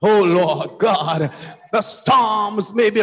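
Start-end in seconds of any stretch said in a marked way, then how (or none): none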